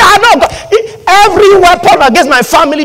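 high, loud, wailing mock prayer; comic anguished plea, very high; mock panic. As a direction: cry out in hammed-up alarm. Mocks believer panicking at sacrifice.

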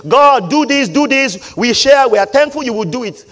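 mock urgent prayer. Preacher imitating believers asking God for things, mock urgent prayer. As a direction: fire off the requests like a mock prayer. Mimics people's demanding prayers.

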